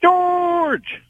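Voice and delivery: high drawn-out holler of a name; exuberant greeting. Real, not acted. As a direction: call out to the host in one long, high shout. Caller's exuberant greeting to host.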